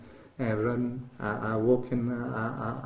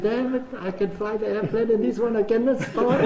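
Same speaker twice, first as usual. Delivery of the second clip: laughing; 9 semitones higher, 7 dB louder than usual. Real, not acted. Quotes himself, laughing.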